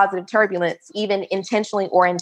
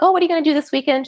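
casual chatty question; 8 semitones higher, much faster than usual. Retold or imitated. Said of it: "we were like".

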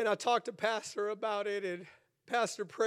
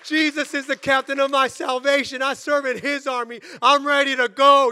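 loud, high, fired-up preacher; booming, impassioned exhortation; full-force preaching; 7 semitones higher, 11 dB louder than usual. Real, not acted. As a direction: shout it as a call to battle.

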